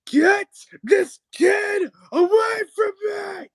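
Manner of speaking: very high, slow, loud wail; mock desperate shout. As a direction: scream for rescue, hammed-up and drawn out. Mock panic over a kid.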